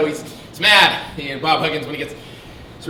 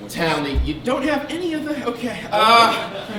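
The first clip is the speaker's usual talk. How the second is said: usual speaking voice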